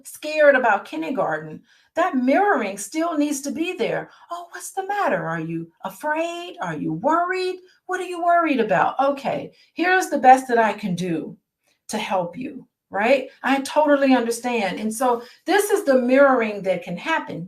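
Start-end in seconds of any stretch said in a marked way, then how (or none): 4.3-12.7 gentle comforting parent. Retold or imitated. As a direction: ask gently, like a parent calming a scared child. Parent comforting scared kindergartner.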